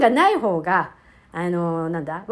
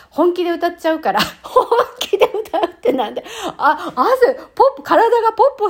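high, loud words through laughter; helpless giggling at yourself; real laughing; 8 semitones higher, 5 dB louder than usual. Real, not acted. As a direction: crack up while confessing. Laughs at singing in earnest.

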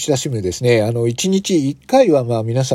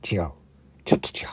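whisper; 7 semitones lower, 9 dB quieter, faster than usual. Real, not acted. Disappointed aside.

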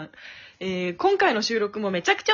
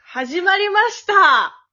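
loud, drawn-out, raised opening call; show opening; 6 semitones higher, 6 dB louder, slower than usual. Pretend. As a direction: kick the show off with a big stretched greeting.

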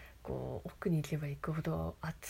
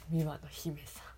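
ordinary talk

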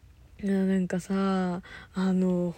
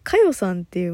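ordinary talk

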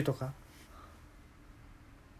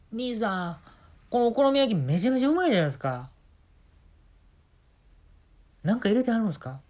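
impressed guest; 4 semitones higher than usual. Retold or imitated. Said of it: "よく言われんねや".

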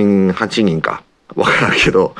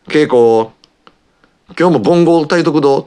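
a hint of old Kansai grandma, higher and brisk; imitating grandma; 6 semitones higher than usual. Grandma tells mom she'll cook five cups, lightly imitated. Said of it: "って言うんで".

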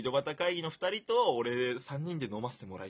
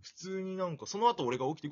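normal talking voice

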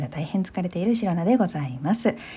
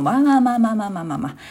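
fast; 4 semitones higher, 5 dB louder, faster than usual. Real, not acted. Brushing off her own rambling.

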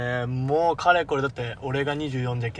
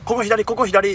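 mother giving directions; 7 semitones higher, 5 dB louder, slower than usual. Retold or imitated. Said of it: "めちゃくちゃ関西弁".

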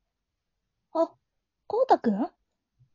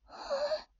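flustered gasp; 12 semitones higher, 10 dB quieter than usual. Acted. Heroine overwhelmed.

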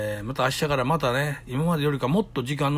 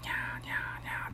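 soft breathy kitten mews, barely audible; tiny kitten mewing; 13 dB quieter than usual. Retold or imitated. Telling of a kitten in the rain, tiny whispered mews. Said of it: "猫の声が".

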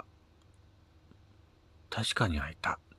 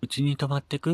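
lightly voiced girl, higher and louder, inviting; a hint of a young woman casually offering her place; coworker girl's invitation; 7 semitones higher, 5 dB louder than usual. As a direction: colleague asks him to stay over, lightly voiced invite. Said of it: "て言われたけど".

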